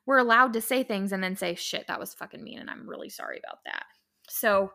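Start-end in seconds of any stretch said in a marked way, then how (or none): 1.5-3.8 soft, hushed whisper, owning up to a mistake; whispered apology. Retold or imitated. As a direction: drop to a sheepish whisper. Acting out a sheepish apology.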